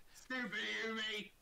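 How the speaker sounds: high, slow mocking call; jeering orc. Orc insulting the intruder at the gate, high jeer.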